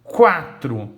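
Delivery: "quatro" loud, with a clear KW onset; U pronunciado. Shown as a demonstration. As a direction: punch "quatro" as KWA-tro, U audible. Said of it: "porque eu ouço o som do U". ("quatro" com o U bem audível)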